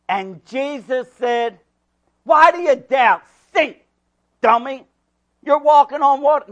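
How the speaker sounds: loud, rising preacher's voice; full-force preaching. Acted. Pastor reading scripture loud to the congregation. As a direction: preach it out to the back row.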